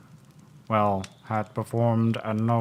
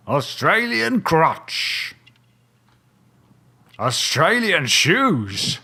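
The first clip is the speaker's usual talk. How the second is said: mock-grand clue listing; 7 semitones higher, 7 dB louder, slower than usual. Detective listing clues, mock grand.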